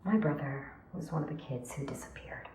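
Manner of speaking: very soft, near-whisper; barely voiced sad admission; hushed confession. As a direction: confide it painfully under the breath. Painful confession about lost brother.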